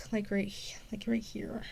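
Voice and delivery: soft unvoiced aside; whisper. Real, not acted. Girl showing where the needle went, whispered. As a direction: breathe it as an aside. Pointing out spot on neck.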